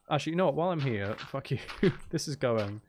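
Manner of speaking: normal talking voice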